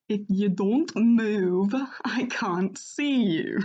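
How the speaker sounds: slow, badly faked Scottish accent; loud, stretched Scottish dog character attempt; Doggo. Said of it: "I imagine the dogs having Scottish accents".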